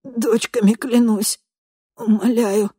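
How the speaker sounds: high slow pleading; begging. A narrator's reading. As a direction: plead desperately. Victim begs for his life.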